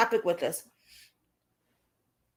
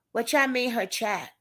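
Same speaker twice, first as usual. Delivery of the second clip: ordinary talk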